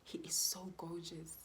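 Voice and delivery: put-on whispered wonder at the baby; retold tender whisper; awed whisper. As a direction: breathe it as a new mother. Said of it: "the first thing that I said".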